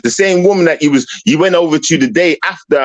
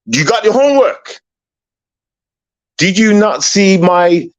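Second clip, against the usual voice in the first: normal talking voice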